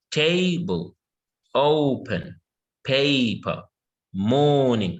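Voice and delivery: "table, open, paper, morning", each opening syllable drawn out, then falling; stress on first syllable. Shown as a demonstration. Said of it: "the stress is on the first syllable".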